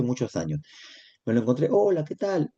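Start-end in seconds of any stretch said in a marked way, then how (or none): 1.7-2.5 saludo alegre. Retold or imitated. Saludo al exalumno.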